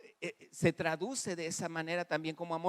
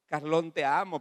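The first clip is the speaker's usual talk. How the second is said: mock declaration; 5 dB louder than usual. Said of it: "Yo no puedo decir muchas veces".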